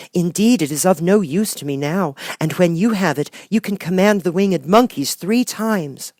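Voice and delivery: put-on high, earnest young girl's voice; a small girl longing for home; little girl Dorothy. Dorothy telling the good witch she wants home, girlish and earnest.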